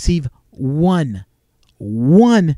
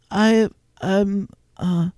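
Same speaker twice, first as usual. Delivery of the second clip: imitated flummoxed stammer; 5 semitones higher, much slower than usual. Telemarketer baffled by a prank caller, flummoxed stammer. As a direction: play someone lost for an answer. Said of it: "did not know how to answer my questions".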